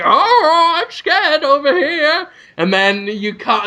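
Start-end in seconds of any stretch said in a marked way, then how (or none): 0.0-2.3 high, scared cartoon voice; Cringe. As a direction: put on a high-pitched cowardly whimper. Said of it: "And then Cringe is like".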